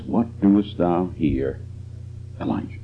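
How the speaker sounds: low, slow and soft; still small voice. Acted. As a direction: quietly ask the question of God. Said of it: "it says".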